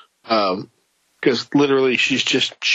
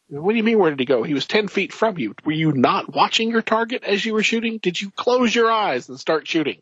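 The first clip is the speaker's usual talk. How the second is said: higher, incredulous scolding; mock exasperated commander; 8 semitones higher than usual. Commander berating soldiers who lost him, higher and incredulous. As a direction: chew out the squad like a fed-up boss. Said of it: "and they're like".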